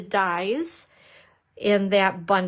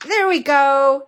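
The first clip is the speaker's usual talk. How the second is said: louder, drawn-out victory cry; triumphant; 4 semitones higher, 8 dB louder than usual. Real, not acted. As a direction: celebrate finally getting it.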